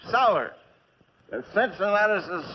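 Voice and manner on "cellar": cellar said singular, rising to a firm fall; stress on cellar. Acted. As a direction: lean on cellar, one clipped falling word. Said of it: "Not cellars".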